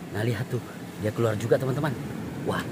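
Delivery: hushed. Real, not acted. Vlogger watches a crab emerge without scaring it, hushed. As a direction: keep it hushed so the crab stays out. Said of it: "jangan ribut".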